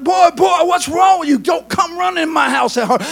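high-pitched, indignant telling-off; scolding grandpa. Acted. Grandpa to boy running into his house, scolding.

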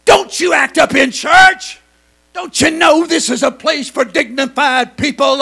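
loud, scolding shout, slowed on the shut ups; crowd hushing angrily. Acted. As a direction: rebuke him like an outraged churchgoer. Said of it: "everybody around him said".

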